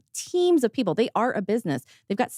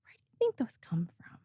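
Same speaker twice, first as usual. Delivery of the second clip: retold sly aside; 4 semitones higher, 6 dB quieter than usual. Retells own sly reply to client.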